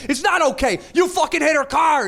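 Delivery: high, shouted protest; retold shout. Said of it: "I'm yelling at this guy".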